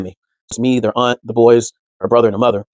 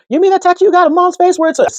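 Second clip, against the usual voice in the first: much higher, mocking child; teasing kid voice; 3 dB louder than usual. Middle son teasing him about his tattoo, high mock kid voice. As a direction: jab at him like a cheeky kid.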